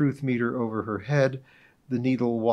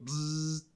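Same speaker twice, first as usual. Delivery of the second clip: buzzer noise; 5 semitones higher, 8 dB quieter than usual. Acted. Narrator buzzes buzzer interruption.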